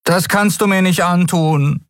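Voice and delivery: high-pitched desperate plea; pleading. A narrator's reading. Miko begs Nelen over a card game, pleading. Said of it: "flehte Miko".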